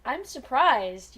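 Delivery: normal talking voice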